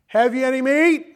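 Jesus calling to the weary fishermen from the shore. Retold or imitated. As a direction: call out across the water to the boat. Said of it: "Jesus is standing there on the shore".

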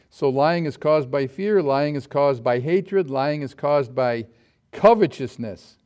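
loud, slow, emphatic list; slow preaching cadence, punched at the end. Acted. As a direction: build the list with force. Preaching climax.

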